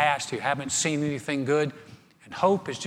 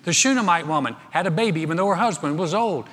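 ordinary talk

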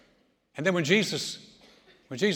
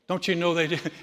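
usual speaking voice

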